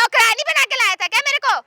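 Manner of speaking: high-pitched indignant challenge; indignant retort. Acted. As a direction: fling the question back, offended.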